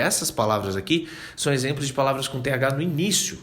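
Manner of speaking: ordinary talk, nothing marked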